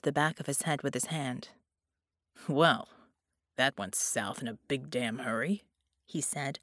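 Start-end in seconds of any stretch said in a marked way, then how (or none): 2.5-5.6 irritated, more fed up than furious; annoyed. A narrator's reading. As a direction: grumble it with annoyance. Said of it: "sounding more annoyed than angry".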